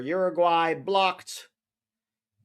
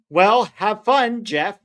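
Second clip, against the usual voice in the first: teasing sarcasm; 7 semitones higher, 6 dB louder, slower than usual. Real, not acted. Teasing reply to the chat.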